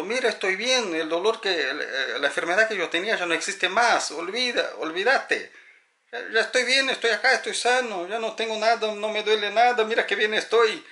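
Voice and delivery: a spirit trying to convince the living it's well; insisting spirit. Acted. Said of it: "intenta sacudir a la persona diciendo".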